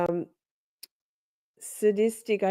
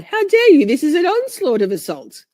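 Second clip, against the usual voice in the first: mock outrage; 8 semitones higher, 5 dB louder than usual.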